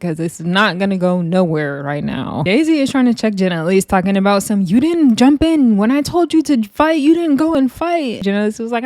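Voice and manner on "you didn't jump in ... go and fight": high mock scolding. Daisy scolding Jen Elise.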